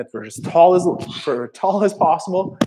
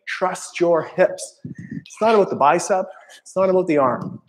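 normal talking voice

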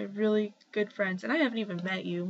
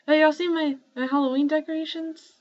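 higher and louder, sudden eager question; excited; 7 semitones higher, 6 dB louder than usual. Real, not acted. Showing viewers her Halloween decorations, excited. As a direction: burst out as if you just noticed.

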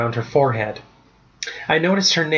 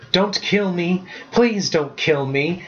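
high, pleading cry at normal volume; panicked begging, restrained shriek; narrated shriek; 5 semitones higher, slower than usual. A narrator's reading. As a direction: beg for your life, drawing out the plea. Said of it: "Hudson shrieked".

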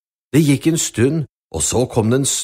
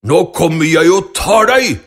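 loud troll bellow; troll screaming; 3 semitones higher, 5 dB louder than usual. Acted. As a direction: roar it like an angry troll. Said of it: "skrek trollet".

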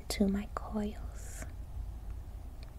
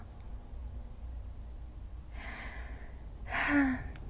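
long sigh; 5 semitones higher, 6 dB quieter than usual. Acted. Sighs before answering mother.

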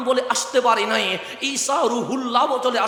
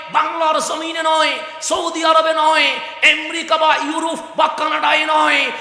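higher, loud building sermon shout; preaching at full force; 5 semitones higher, 5 dB louder than usual. Acted. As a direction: build to a fervent climax. (উচ্চস্বরে ওয়াজের চূড়ান্ত গর্জন)

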